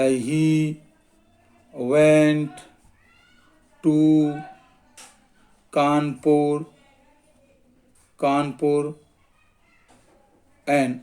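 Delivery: word by word, long gaps; slow dictation. Shown as a demonstration. Teacher writing out a hyphen example, slow dictation. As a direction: dictate at the speed of handwriting.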